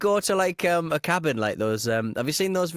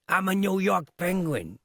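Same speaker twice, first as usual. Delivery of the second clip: put-on a light New York accent, pitched up; mock New York accent; 7 semitones higher than usual. Cohost jokingly echoes the show's villain, light New York accent. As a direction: play a playful New York mobster. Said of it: "he's a New Yorker".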